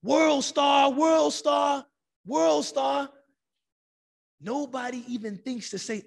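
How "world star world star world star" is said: loud mock chant, pitched up; imitated crowd yelling. Young people filming a subway fight, imitated loud chanting yell.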